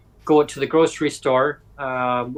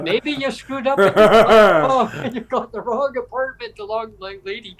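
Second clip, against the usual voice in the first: laughing at himself; 8 semitones higher, 5 dB louder than usual. Real, not acted. Laughing at his own blunder.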